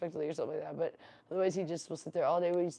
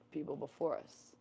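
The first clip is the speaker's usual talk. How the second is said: ordinary talk, nothing marked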